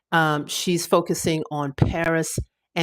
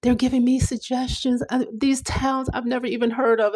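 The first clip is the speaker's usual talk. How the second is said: higher, eager mock friend voice; imitating French friends; 7 semitones higher than usual. Imitating French friends correcting her travel plans, higher and eager. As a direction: play the friends brushing off her town list, pitched up. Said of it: "they're like".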